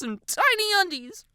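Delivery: high, emphatic wail on TINY UNDIES; exasperated whine. A narrator's reading. Girl ending clothes rant, exasperated. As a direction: whine it out at full voice.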